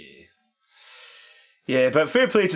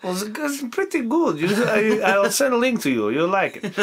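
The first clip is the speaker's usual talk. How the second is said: higher-pitched impression of a friend; put-on friend's voice, pitched up; 7 semitones higher than usual. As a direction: mimic an earnest mate recommending something. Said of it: "I was doing my A J impression".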